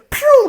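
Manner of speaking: high, loud imitated zoom; a quick "pew" of people scattering; comic getaway noise; whoosh sound effect. Imitates bystanders darting away.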